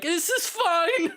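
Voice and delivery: high, drawn-out strained cry; slow, high-pitched comic despair; mock panic. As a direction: feign being fine while panicking, half laughing. Acted panicking player facing tyrant.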